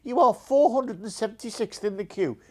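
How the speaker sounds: a light send-up of a hold message; automated phone queue voice. Retold or imitated. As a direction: mimic the call-centre robot lightly. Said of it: "it's not like".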